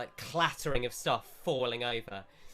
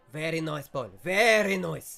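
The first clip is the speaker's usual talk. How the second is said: louder, higher, fast mock Italian shopkeeper; shopkeeper accent; 3 semitones higher, 8 dB louder than usual. Shopkeeper brushes off customer, louder fast mock Italian accent. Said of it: "He says".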